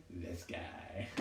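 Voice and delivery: unvoiced whisper to the cat; hushed aside; whispered this guy. Real, not acted. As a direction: whisper it like a secret. Pointing at the cat.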